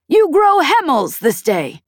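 put-on high, loud scorn from an old pirate; scornful scolding. A narrator's reading. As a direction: snap at the fool child. Peg scolds.

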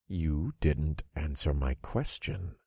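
slow, soft, low taunt; smirking menace. A narrator's reading. Narrator toying with the begging warlock, smirking menace. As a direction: press the victim quietly and slowly, with a smirk. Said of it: "I smirked".

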